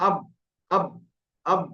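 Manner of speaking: short separate "আব", stopped on the outer lips; dry-lip ba. Shown as a demonstration. Teacher contrasts ba with meem, dry-lip "আব". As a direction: close "আব" with a firm b. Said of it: "দুটো ঠোঁটের শুকনো অংশ".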